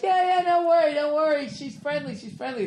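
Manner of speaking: high, soft airy mock reassurance; mocking dog owners. Mocking dog owners who unleash dogs, high dismissive reassurance. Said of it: "They're like".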